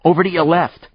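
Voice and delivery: high, loud warning cry; shouting warning. Acted. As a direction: shout the warning across the street. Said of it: "Static was shouting".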